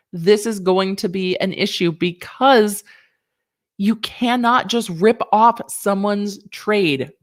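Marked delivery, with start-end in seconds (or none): none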